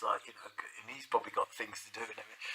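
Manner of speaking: fast, hushed whisper; whispered aside. Real, not acted. Narrator whispering an aside about the busy headmaster, fast. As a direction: whisper the aside quickly under your breath.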